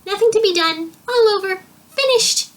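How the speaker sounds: very high, loud croaky little creature; croaking. A narrator's reading. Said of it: "croaked the smallest book".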